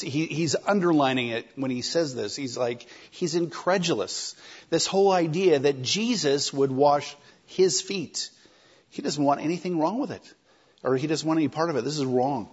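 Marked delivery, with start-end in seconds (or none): none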